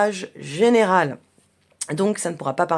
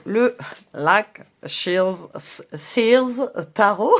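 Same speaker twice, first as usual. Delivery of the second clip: drawn-out mock English accent; much slower than usual. Shown as a demonstration. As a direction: say the English name in a playful English accent. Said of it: "j'ai un merveilleux accent, euh, anglais".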